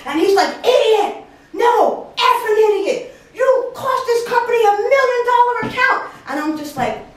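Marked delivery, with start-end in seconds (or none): 0.6-6.1 high-pitched angry tirade, acted out; irate boss. Boss blames employee for lost account, furious mock outburst. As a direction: imitate a boss exploding at staff. Boss berating her in retold scene.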